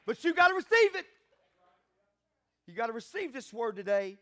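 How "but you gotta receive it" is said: high, loud preacher's proclamation; full-force preaching. Real, not acted. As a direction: exhort the congregation forcefully, pitch lifted.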